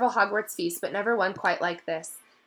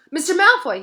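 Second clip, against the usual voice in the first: higher, louder breathless call; gasped call; 10 semitones higher, 10 dB louder than usual. A narrator's reading. Harry calls to Malfoy after running down the corridor. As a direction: catch him up, panting. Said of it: "he gasped".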